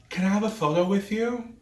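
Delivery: high, eager fan voice; fawning coworker. Retold or imitated. Coworker asking the celebrity for a photo, eager and high. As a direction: mimic a starstruck coworker asking sweetly. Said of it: "she goes".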